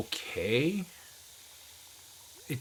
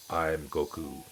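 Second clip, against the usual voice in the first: lightly voiced, lower anime hero; slight imitation of a deep dub voice; deeper Goku voice; 5 semitones lower, slower than usual. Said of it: "that deeper voice, sort of like".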